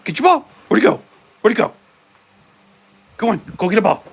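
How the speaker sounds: high, loud cheerful voice for the dog; excited dog talk. Real, not acted. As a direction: rev the dog up to fetch.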